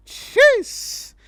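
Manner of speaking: sudden loud high "Jeez!"; surprised exclamation. Real, not acted. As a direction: yelp in shock at the tweet. Reaction to Dave's tweet.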